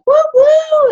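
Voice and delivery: loud, very high "woo-woo" whoops; whooping yell. Retold or imitated. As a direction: imitate rowdy hikers hollering on the trail. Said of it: "and, like, yelling things".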